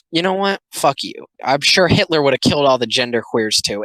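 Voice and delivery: usual speaking voice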